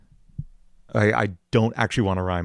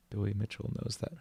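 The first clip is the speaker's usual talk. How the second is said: quiet; 15 dB quieter than usual. Acted. Line tried softly.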